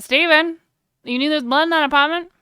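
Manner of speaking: put-on southern accent spoken out of the mouth corner. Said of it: "a southern type of accent if I talk out of the side of my mouth".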